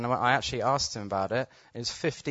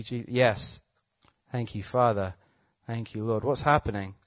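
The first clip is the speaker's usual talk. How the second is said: healing prayer ministry. Real, not acted. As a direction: pray over the crowd, unhurried and assured.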